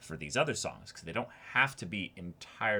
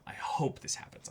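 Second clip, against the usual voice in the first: hushed, secret wish; a breathless little prayer; whispered hope; 4 dB quieter than usual. Real, not acted. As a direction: whisper it to yourself. Hopeful aside, repeated.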